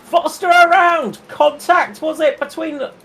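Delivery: high, urgent crash call; excited commentary. Real, not acted. Crash called live.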